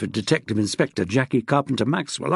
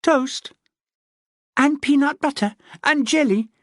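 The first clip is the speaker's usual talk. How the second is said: put-on higher child's eager voice; young son listing breakfast, childlike; boy's voice; 11 semitones higher than usual. A narrator's reading. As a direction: sound like a small boy. Boy voiced in story.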